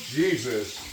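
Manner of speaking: normal talking voice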